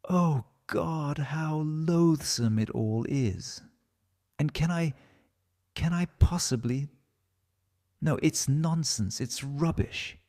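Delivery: a lightly voiced young man in torment; anguished cry. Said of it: "he cried out".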